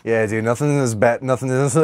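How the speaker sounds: ordinary delivery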